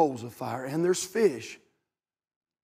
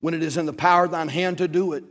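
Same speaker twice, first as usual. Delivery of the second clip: scripture reading; 5 dB louder than usual. Acted. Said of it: "says it like this".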